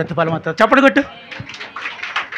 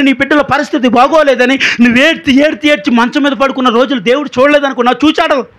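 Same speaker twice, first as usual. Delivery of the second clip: higher, louder, rising preacher's appeal; fervent preaching; 6 semitones higher, 5 dB louder than usual. Acted. Preacher appeals to the congregation with mounting fervour. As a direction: hammer each question home to the crowd.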